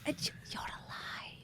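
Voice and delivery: awed whisper, almost no voice. Acted. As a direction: breathe it out in disbelief. Repeated in a hushed whisper.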